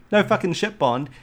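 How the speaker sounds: mocking, pitch jumps high; sarcastic. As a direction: scoff at Bond's lack of subtlety.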